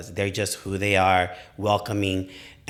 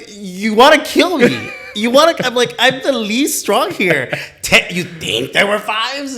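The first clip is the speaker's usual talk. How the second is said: laughing disbelief; 14 semitones higher, 10 dB louder, slower than usual. Retold or imitated. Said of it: "I felt like going".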